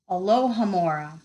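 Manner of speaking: usual speaking voice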